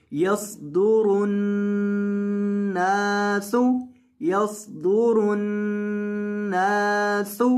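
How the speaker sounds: यसदुरुन नासु slowly with whistled सीन; recitation drill. Shown as a demonstration. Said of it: "सीन के अंदर आपने सीटी पैदा करनी है". (सीन में सीटी के साथ धीरे)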